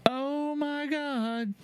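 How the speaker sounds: high, drawn-out horrified gasp of words; appalled squeal, very slow; shocked disbelief. Real, not acted. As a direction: stretch it out in sheer disbelief. Reacting to texts.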